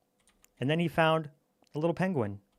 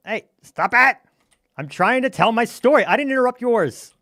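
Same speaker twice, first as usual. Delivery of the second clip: loud, high, fed-up protest; storyteller yelling at a heckler, loud and indignant; indignant shout; 7 semitones higher, 10 dB louder than usual. Real, not acted. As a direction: yell at the heckler to stop interrupting. Story interrupted by other player.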